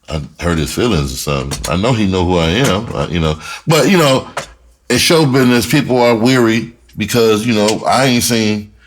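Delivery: usual speaking voice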